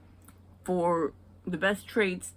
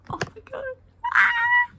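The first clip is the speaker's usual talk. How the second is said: squealing delight; 8 dB louder than usual. Real, not acted. Overwhelmed by daughter's cuteness.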